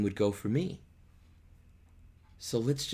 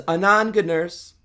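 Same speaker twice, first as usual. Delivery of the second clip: loud call toward the house, pitched high; 9 semitones higher, 9 dB louder than usual. Acted. Juliet calling back to the nurse inside, loud high call.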